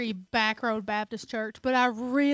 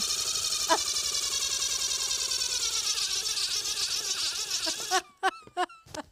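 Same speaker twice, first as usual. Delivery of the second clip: long high laugh; 11 semitones higher than usual. Real, not acted. Long laugh after surprise.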